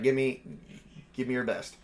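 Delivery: ordinary talk, nothing marked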